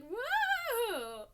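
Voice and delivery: high loud cartoon whoa; playful mock scream; spinning scream. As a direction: yell it while spinning around. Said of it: "screaming like".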